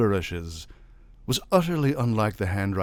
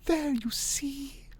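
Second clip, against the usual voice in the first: high, slow whisper; 4 dB quieter, slower than usual. A narrator's reading. Wife to husband at the séance, triumphant whisper. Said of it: "whispered his wife".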